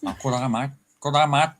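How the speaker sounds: put-on a cheesy tourist's take on an Aussie accent; mock Australian. Host mimics how fans talk: mock Australian accent. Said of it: "a lot of them go".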